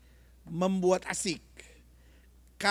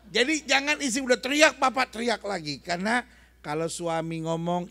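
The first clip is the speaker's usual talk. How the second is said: ordinary talk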